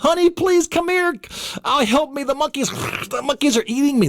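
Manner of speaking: high, panicked cry to his wife; hammed-up plea for help while monkeys bite; mock panic. Being eaten by monkeys, calling wife.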